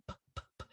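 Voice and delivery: breathy voiceless P, P, P; just lips, no sound; unvoiced P. Shown as a demonstration. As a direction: silent lip pops for P. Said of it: "make your lips do the same thing".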